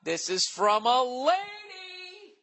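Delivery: put-on high, slow, drawn-out girly squeal; high mock girly voice. Reading a listener letter aloud, in a high mock girly squeal. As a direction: squeal it like a shocked young woman. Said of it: "she says".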